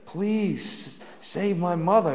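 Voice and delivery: slowed, mild tearful plea; retold begging while crying, lightly done; pleading through tears.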